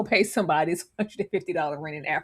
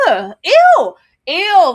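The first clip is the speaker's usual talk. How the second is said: high loud disgusted shriek; retold disgusted scream; 9 semitones higher, 11 dB louder, much slower than usual. Woman recoiling from a man on TV, shrieking. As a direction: screech ew in disgust.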